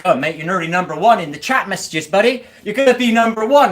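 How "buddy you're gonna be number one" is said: higher, louder gloating encouragement; sarcastic hype for the troll; mock excited cheer. Real, not acted. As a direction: egg him on with fake excitement.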